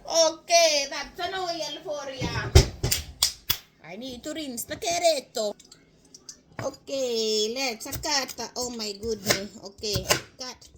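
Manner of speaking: exaggerated Italian-cook accent. Cook rinsing and cutting the carrot, in an Italian accent. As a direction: ham it up in Italian English. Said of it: "Forgive my Italian accent".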